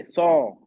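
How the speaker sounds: सौ as one long open aw; model saw. Shown as a demonstration. Teacher giving the student the sound to repeat first, long open सौ. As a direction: hold सौ with a heavy open vowel. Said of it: "पहले ये बोलिए".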